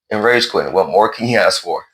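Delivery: normal talking voice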